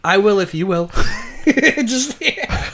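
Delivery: loud, high, laughing through it; can't hold the laugh in. Real, not acted. Quoting a sauce packet to cohost, laughing.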